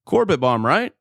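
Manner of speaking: ordinary delivery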